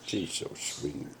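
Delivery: whispered, slow and tender. Real, not acted. Quiet aside over baby.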